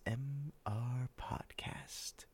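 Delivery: slow breathy ASMR whisper; right in your ear. Real, not acted. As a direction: whisper the sign-off close to the mic. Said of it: "an older man whispering into your ear".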